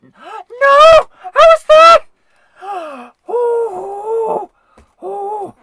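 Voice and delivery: gasping, very high and loud; shocked shriek. Real, not acted. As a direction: shriek in disbelief at losing. Character dies in game.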